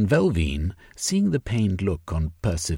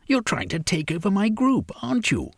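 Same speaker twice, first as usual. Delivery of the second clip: higher, challenging woman's voice; Sylvia accusing; 14 semitones higher, faster than usual. Acted. Sylvia accusing Velvein of a takeover, pitched high. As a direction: throw the charge at him.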